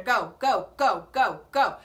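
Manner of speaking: hurry-up chant; urging go go. Real, not acted. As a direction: shoo him off the stage. Urging Brian off stage.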